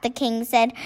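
plain reading voice

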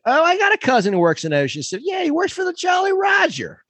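high, hammed-up Maryland local; mock Maryland accent. Local bragging about a cousin, shrieky Maryland accent. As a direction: shoot the pitch way up, thick Maryland accent. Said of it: "everyone has a cousin who works in Ocean City".

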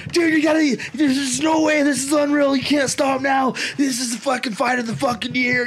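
mock-imitated corner man yelling encouragement; hyped-up corner man. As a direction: fire the fighter up like he's losing his mind. Corner man hyping the fighter.

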